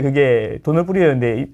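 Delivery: ordinary delivery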